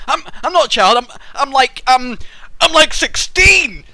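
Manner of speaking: put-on whiny teenager. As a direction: protest like a sulky teenager. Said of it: "you'd be like".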